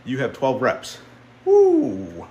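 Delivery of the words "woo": high, loud triumphant whoop; real joy after lifting; excited whoop. Real, not acted. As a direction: let it out after a big set. Excited at twelve reps.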